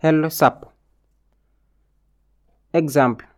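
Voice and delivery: ordinary delivery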